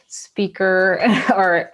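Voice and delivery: slow, laughing through the word; a small embarrassed laugh mid-word. Real, not acted. Guest introducing her early speaker role, laughing.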